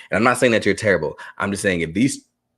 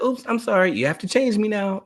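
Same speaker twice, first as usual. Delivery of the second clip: put-on much higher, breezy little-kid oops; careless toddler; 12 semitones higher than usual. Son apologizing lightly after an accident, high and breezy. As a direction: sound like a kid without a care.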